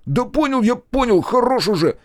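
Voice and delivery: high, loud, exasperated yell; pleading shout. A narrator's reading. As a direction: cry out in a high voice to call it off. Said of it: "крикнул он". (крикнуть высоко и громко)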